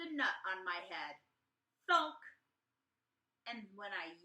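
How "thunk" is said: thunk. Acted. Sound word for nut hitting.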